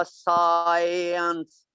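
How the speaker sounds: "साइंस" stretched slowly through its vowel glide; drawn-out glides. Shown as a demonstration. Teacher showing students the glides, "साइंस" drawn out. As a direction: hold the long gliding vowel in "साइंस". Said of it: "कितनी ग्लाइडें आई हैं".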